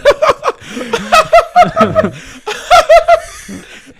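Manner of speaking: long high burst of laughing; loud laughter. Real, not acted. Big laugh after story.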